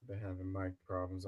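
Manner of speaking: ordinary talk, nothing marked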